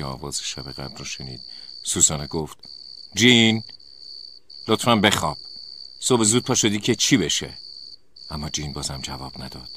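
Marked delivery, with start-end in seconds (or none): 3.1-7.6 loud, raised complaint to a roommate; exasperated. A narrator's reading. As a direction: plead sharply for some sleep. Woken sleeper scolds singing roommate.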